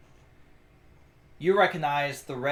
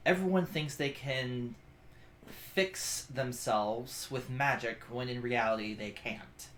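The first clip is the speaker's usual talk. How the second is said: soft, slow weary explanation; sighing resignation; 3 semitones lower, 8 dB quieter, slower than usual. Acted. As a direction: let it sink softly, as after a sigh. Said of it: "she sighs, and she goes".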